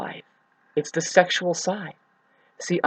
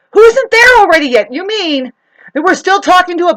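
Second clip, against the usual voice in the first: much higher, louder mocking cry; scoffing shout; 13 semitones higher, 11 dB louder than usual. Real, not acted. Ranting about the law of attraction, loud scornful outburst. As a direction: throw it out as a loud scornful jab.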